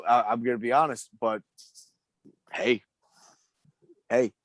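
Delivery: ordinary talk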